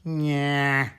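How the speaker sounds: a long, held nasal "nya"; nasal puppet sound. Shown as a demonstration. Teacher demonstrating a puppet voice's core sound, very nasal. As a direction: drone it from back of nose and throat. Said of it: "a very nasal sound, a little bit like Bugs Bunny".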